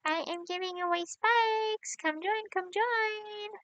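high, slow sing-song invitation; sing-song call. Pretend. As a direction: call out to the whole lobby. Announcing a giveaway in game.

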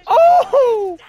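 loud high laughing yell; laughing shout. Real, not acted. Sudden in-game kill.

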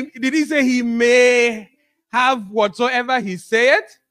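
usual speaking voice

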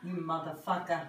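ordinary delivery

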